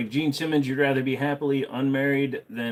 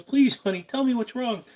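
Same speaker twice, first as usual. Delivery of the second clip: higher pleading wife voice; worried wife; 14 semitones higher, 4 dB louder than usual. Acted.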